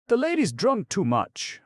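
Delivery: high, loud gossiping voice; giggling remark. A narrator's reading. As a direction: tell the girls, tittering.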